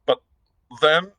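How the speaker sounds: stunned and breaking off. Acted. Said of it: "and still more aghast".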